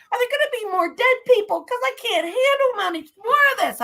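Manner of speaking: high, fast mock-distraught rush; retold tearful outburst. Wife retelling her upset to husband, high and frantic. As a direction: act out being overwhelmed, pitch up and racing. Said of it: "and I'm crying".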